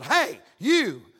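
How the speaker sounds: loud mock challenge; abrupt, loud summons; calling out sharply. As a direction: imitate the barber singling someone out. Barber calls out to him.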